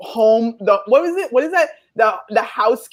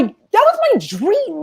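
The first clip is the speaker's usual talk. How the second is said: dreamy excitement; 14 semitones higher than usual. Real, not acted.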